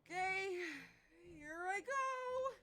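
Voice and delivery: slow, high, nervous build-up; shaky and unsure. Acted. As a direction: brace yourself, stretching the words out nervously. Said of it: "Her voice sounds incredibly shaky and unsure".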